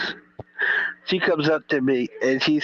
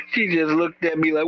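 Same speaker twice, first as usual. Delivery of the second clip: normal talking voice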